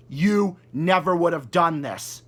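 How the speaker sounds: normal talking voice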